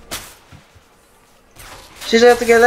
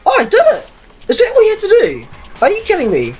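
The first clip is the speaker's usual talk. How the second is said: high squeal of disbelief; surprised; 13 semitones higher, faster than usual. Real, not acted. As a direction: squeak it out in shock. Surprised success in game.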